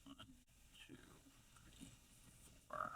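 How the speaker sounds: counting stitches under the breath; whispered counting. Real, not acted.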